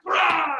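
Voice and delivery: sudden loud roar; scare roar. Retold or imitated. Retelling friends jumping out at the girls, scare roar. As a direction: jump out and startle them.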